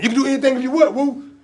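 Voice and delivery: high, loud hyped-up coaching; rousing encouragement. Acted. Uncle pumping up doubting nephew, rousing shout. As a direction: suddenly burst out cheering him on.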